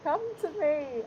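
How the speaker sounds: high, loud summoning call; summoning shout. Pretend. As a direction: bellow to summon your steed. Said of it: "I summon my steed".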